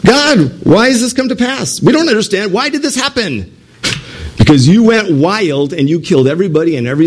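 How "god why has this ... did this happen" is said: high bewildered plea; blaming God. Acted. Israelites blaming God for their loss, high bewildered plea. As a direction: plead upward, not understanding. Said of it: "they're blaming God".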